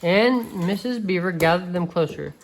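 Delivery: ordinary delivery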